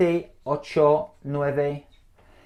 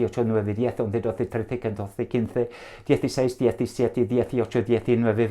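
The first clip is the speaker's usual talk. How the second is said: as fast as possible; 2 semitones lower than usual. Shown as a demonstration. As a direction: rattle the numbers off at top speed. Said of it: "say them as fast as I can".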